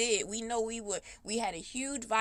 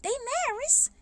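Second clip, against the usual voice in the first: much higher, sing-song mock witness voice; mocking church witness; 13 semitones higher than usual. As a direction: parody the church lady announcing the marriage. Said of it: "And they supposed to be".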